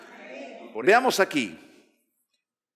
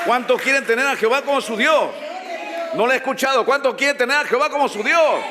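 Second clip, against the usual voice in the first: loud, high call to the crowd; rousing preacher's shouted question; rallying shout; 7 semitones higher, 4 dB louder, faster than usual. Real, not acted. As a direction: demand an answer from the whole congregation. Rousing congregation to answer louder.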